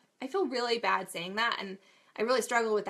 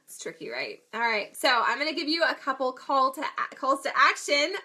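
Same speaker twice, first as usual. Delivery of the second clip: usual speaking voice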